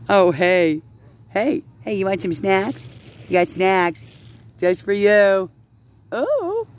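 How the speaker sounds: high-pitched pet talk; coaxing ducks. Real, not acted. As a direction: call the ducks over in a high voice. Calling ducks over for snacks.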